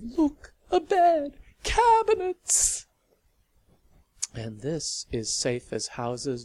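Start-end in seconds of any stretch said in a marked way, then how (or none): 0.0-2.9 much higher, louder delighted cries; rhapsodic cries. Acted. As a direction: squeal with delight. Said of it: "with rhapsodic cries of".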